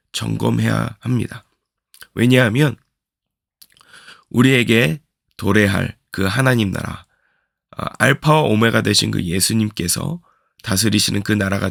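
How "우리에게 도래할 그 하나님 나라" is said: ordinary delivery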